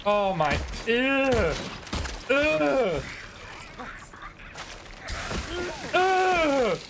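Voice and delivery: high, strained frightened groans; real terror, rising cries; phobic panic. Real, not acted. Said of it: "I didn't handle that very well".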